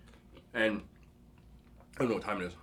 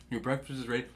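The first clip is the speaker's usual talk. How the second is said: gentle, softer mom calling her son to breakfast; soothing mom voice; 8 dB quieter than usual. Retold or imitated. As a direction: wake the kid softly like a loving mother. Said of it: "she would say it like that".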